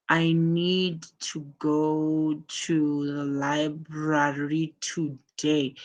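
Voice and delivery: drawn-out, evenly held words at about half speed; slow example sentence. Shown as a demonstration. Teacher showing pupils an example of emphasis, slow and drawn-out. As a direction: stretch each word out slowly, deliberately. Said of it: "let me just give you an example".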